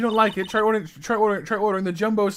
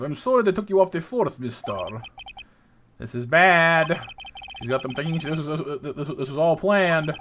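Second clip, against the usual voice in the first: ordinary talk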